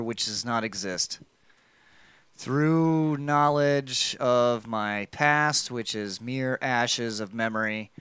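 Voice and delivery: slow, low and ominous; ominous slow reading. Acted. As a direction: read it like a dark incantation. Reading creepy note slowly aloud.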